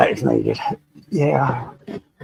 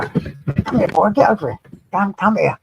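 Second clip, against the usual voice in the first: calling the dog; 5 semitones higher than usual. Real, not acted.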